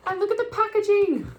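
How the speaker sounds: excited high exclamation; delighted. Real, not acted. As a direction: show it off in pure delight.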